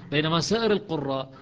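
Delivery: ordinary delivery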